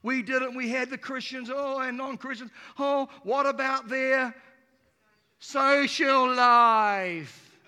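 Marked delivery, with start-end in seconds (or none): 2.8-7.6 loud, drawn-out mock dismay; hammed-up fretting, loud and lingering; mock horror. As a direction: imitate scandalized critics, stretching the key words. Mimics shocked critics of homeschooling.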